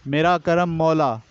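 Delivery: normal talking voice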